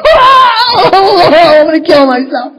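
mock wailing. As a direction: wail it like a heartbroken husband. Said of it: "I'd be like".

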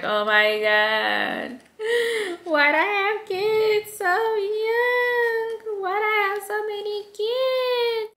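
high, slow sing-song moaning; mock whining lament. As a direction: wail it like an exhausted mom. Resentful mom complaining.

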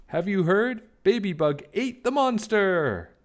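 an inchworm spreading big news; excited shout. Acted. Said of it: "He shouted".

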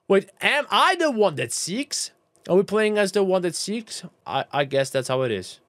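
ordinary delivery

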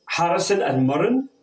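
normal talking voice

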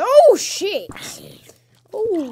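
sudden high shriek of fright; startled yelp. Real, not acted. Gamer startled by a mob, shrieking. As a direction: jump out of your skin.

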